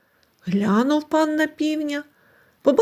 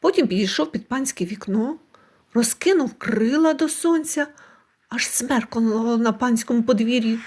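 plain reading voice

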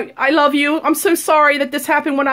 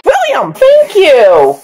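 loud slow call; 2 semitones lower, 7 dB louder, much slower than usual. Acted. Exasperated parent calling out.

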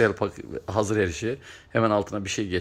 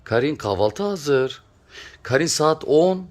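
calling to wake; 6 semitones higher, 5 dB louder, slower than usual. Real, not acted. Waking a pet monkey, calling.

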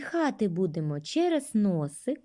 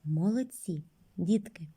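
ordinary talk

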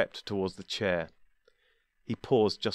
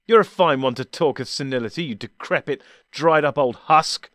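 loud, higher savage insult, slowing at the end; loud savage retort; 5 semitones higher, 8 dB louder than usual. Acted. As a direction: spit the insult at him. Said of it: "the doctor responded savagely".

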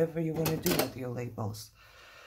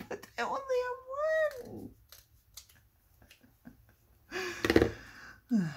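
very high, softening pretend wail; mock whining; much faster than usual. Crafter laments having only one pack, mock whining. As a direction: squeak out a playful lament.

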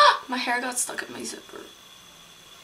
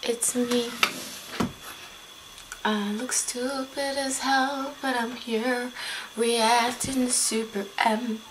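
slow, soft, stretched-out intro; odd drawn-out talk; 8 dB quieter, slower than usual. Pretend. A vlogger opening a reaction video, in an odd drawn-out voice. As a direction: drag the words out softly, playfully odd. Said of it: "Why am I talking like that?".

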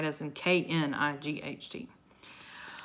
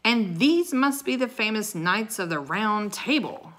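loud, higher mock admiration; 5 semitones higher, 5 dB louder than usual. A narrator's reading. Knight sneers at the famous Round Table, mocking. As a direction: scoff at their fame, loudly. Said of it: "in a mocking voice".

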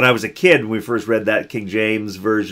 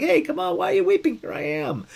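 breezy mock Jesus. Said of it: "And Jesus is like".

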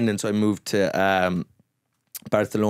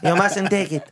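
higher, laughing mock pleading; mock conspiracy flatmate; 7 semitones higher than usual. Mocking the flat-earther flatmate's vaccine fears, laughing high. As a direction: ham up a worried anti-vaxxer.

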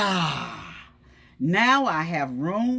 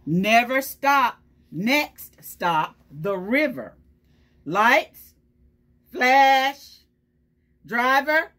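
loud boastful garbage truck; 6 semitones higher, 8 dB louder, slower than usual. Acted. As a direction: boss the machinery around, proudly. Teacher voices the garbage truck.

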